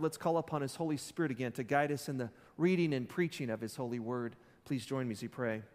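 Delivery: ordinary talk, nothing marked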